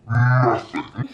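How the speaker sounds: dropped very low for effect; deadpan low. Real, not acted. Reviewer telling viewers the romance left her cold, deadpan low. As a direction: let the word sink to the bottom of your voice. Stressing her total lack of feeling.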